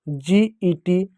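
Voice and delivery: a teacher spelling out G E T slowly. Shown as a demonstration. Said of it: "जैसे".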